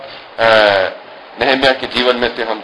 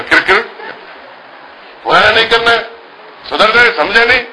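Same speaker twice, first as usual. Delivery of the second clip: pitched high, a half-laughing scold; lightly voiced father telling her off; mock stern father; 8 semitones higher than usual. Father scolds sulking daughter, higher and mock stern.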